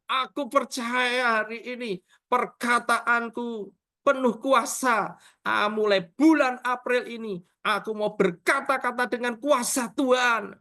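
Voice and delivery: high, emphatic acted declaration; fired-up enthusiasm. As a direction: proclaim it as an eager new witness. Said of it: "dia semangat". (pernyataan penuh semangat)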